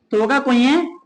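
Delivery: normal talking voice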